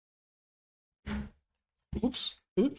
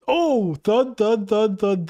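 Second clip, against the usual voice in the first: mock thud sound effect; 4 semitones higher, much slower than usual. Voicing falling bodies landing.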